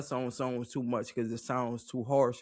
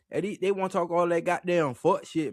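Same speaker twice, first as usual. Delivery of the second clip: ordinary talk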